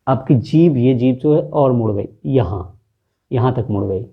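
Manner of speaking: ordinary talk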